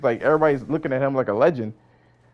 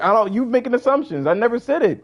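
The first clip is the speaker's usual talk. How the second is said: indignant denial; 9 semitones higher than usual. Real, not acted. Denying he claimed a self-introduction, indignant and high. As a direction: defend yourself hard against the accusation. Defensive argument, insisting.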